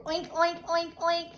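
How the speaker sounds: bouncy mock pig noises; pig oinking. Shown as a demonstration. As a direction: lead the kids in oinking. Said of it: "make that pig sound".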